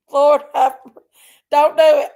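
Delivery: loud, urgent begging; loud pleading. Real, not acted. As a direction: cry out to stop her. Begging a viewer not to donate.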